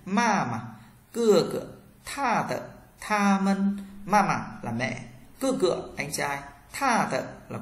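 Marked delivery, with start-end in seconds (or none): 0.1-3.8 "ma mà, cưa cựa, tha tợ, tha mân" with high first syllables, short light second ones; neutral-tone drill. Shown as a demonstration. Teacher leading practice, "ma mà, cưa cựa" with light, cut-off second syllables. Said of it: "thanh nhẹ giữa chừng bạn dừng luôn".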